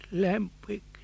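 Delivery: faint, fading dying voice; weak voice. Acted. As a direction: barely get the name out. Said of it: "in a very weak voice".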